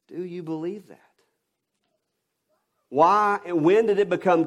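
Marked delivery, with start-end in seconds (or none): 0.1-1.0 softer repeat. Real, not acted. Preacher repeats question, quieter.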